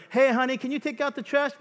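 high sweet request; mock wife. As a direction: put on a sweet wife's ask.

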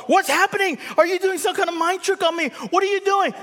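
very high, loud mock alarm; baffled spouse. Preacher plays the spouse, shrill with suspicion. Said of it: "Their brain will explode".

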